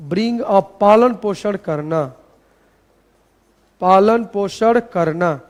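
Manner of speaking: slow, measured dictation with pauses. Shown as a demonstration. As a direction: read it out for students copying it down. (धीरे-धीरे इमला बोलने का अंदाज़)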